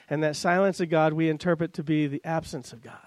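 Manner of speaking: ordinary talk, nothing marked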